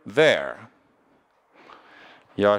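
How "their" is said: their in an American accent, with a hard r; American their, the r sounded. Shown as a demonstration. Teacher contrasting accents for the class: American their. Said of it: "Se fosse americano".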